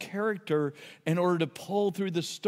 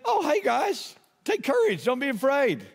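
breezy, much higher, like greeting buddies; casual friendly Jesus; 6 semitones higher than usual. Retold or imitated. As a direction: toss it off lightly, higher pitch. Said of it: "and Jesus said".

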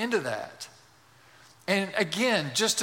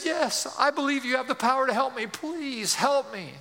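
desperate pleading; 7 semitones higher than usual. Acted. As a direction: play a man begging as his grip slips. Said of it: "losing his grip".

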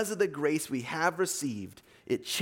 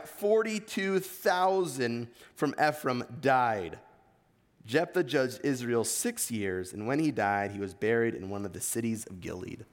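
normal talking voice

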